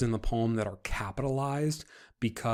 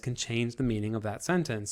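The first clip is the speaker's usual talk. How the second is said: normal talking voice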